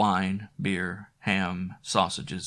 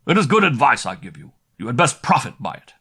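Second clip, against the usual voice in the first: stern rider; 4 semitones higher, 8 dB louder than usual. Acted. Rider warning Wilfred off.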